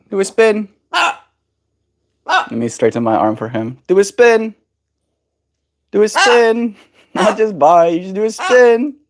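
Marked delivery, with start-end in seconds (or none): 3.9-9.0 loud, high urging; excited coaxing. Real, not acted. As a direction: cheer the bird on. Urging trick.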